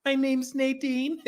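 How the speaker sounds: softly imitating her sweet, low intro; slow, soft, sugary mimic of her name; mock soft sweet voice. Host mimics Nadine's sweet intro, mock soft and slow. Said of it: "everybody just low and soft".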